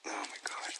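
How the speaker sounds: breathy exasperated whisper; whispered aside. Real, not acted. Owner whispering as the dog starts barking again, hushed. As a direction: breathe it out under your breath.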